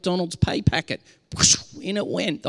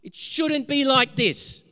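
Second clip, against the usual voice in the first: a loud, high cry to God, then slower; lamenting cry; 7 semitones higher, 4 dB louder, slower than usual. Shown as a demonstration. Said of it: "we cry out and we go".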